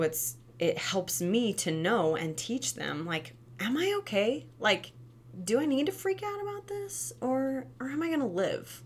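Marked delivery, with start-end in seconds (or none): 3.6-8.8 put-on high wondering little-kid voice; child's inner voice. Toddler checks mom's face after falling, high child voice. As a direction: ask it like a toddler unsure if hurt.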